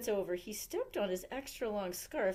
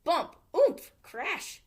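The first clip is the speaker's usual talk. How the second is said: sound effects; 8 semitones higher, 9 dB louder, much slower than usual. A narrator's reading.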